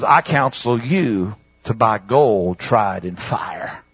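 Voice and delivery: low, slow, soft counsel; grave scripture quote. Acted. Preacher quoting counsel to the Laodicea church, low and slow. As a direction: drop low and draw the words out.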